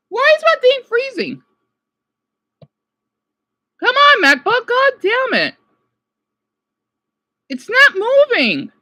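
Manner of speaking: high, loud exasperation at a frozen laptop; pleading angrily with the computer; frustrated outburst. Real, not acted. Streamer yelling at his frozen MacBook, exasperated.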